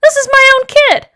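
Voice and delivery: high, loud protest; indignant father. Acted. Father refusing to pay for his daughter, indignant. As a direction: object loudly, outraged.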